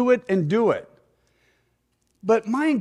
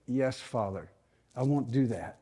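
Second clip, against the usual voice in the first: soft, low obedient reply; humble submission; 4 semitones lower, 8 dB quieter than usual. Acted. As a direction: answer God quietly, yielding to correction. Acts humble answer to God.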